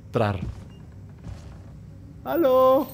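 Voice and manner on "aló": high, held-tone hello; calling out. Real, not acted. Player calling to whoever may be there, high drawn-out call. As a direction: sing out a wary hello, last vowel held.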